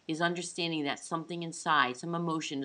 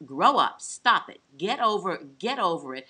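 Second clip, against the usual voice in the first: loud impatient scolding, louder; dismissive loud "get over it"; harsh scolding; 8 dB louder than usual. Retold or imitated. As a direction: snap it like a fed-up parent. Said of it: "we've all been taught".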